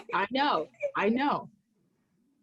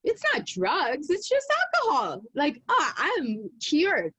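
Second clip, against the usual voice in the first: mock relief; 6 semitones higher than usual. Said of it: "I thought".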